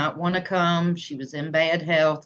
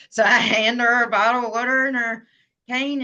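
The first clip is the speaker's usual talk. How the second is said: ordinary talk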